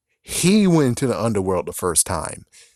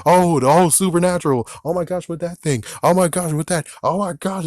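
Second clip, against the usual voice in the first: higher, breathless mock excitement; mock hype; 5 semitones higher than usual. Vlogger mocking the show's marketing, gushing mock hype. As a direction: gush like an overhyped ad. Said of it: "with saying".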